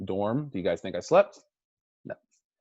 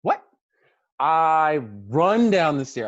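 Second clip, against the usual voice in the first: normal talking voice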